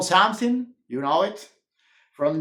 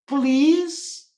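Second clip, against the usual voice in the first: grudging please; 9 semitones higher than usual. Acted. Bossy forced to say please.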